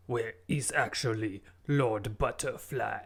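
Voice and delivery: put-on guest in a bogus French accent; fake French accent. Guest answering the host, in a fake French accent. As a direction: lay on a made-up French accent. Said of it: "What is that accent that you're doing?".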